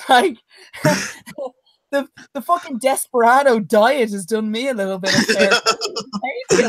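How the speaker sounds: words broken with laughter; laughing. Real, not acted. Joking to his co-host about the diet, laughing. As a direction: giggle through the joke.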